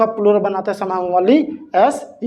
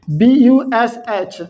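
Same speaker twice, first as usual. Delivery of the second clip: slow spelling; much slower than usual. Shown as a demonstration. Teacher spells word letter by letter.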